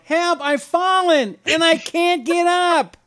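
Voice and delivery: put-on high, slow old woman crying for help. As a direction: plead like an elderly woman who fell. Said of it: "that old woman in that commercial".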